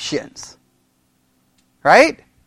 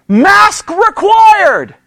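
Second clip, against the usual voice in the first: MASK and REQUIRED drawn out high; emphatic sign reading; 7 semitones higher, 4 dB louder, much slower than usual. Retold or imitated. Pastor quoting the hospital signs, "Mask required" high and drawn out. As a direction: stretch "Mask required" slowly, pitched up. Voicing signs posted everywhere.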